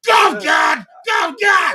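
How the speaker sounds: loud, high whooping cheer; cheering shout. Real, not acted. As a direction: shout it out with joy. Cheering the first performance.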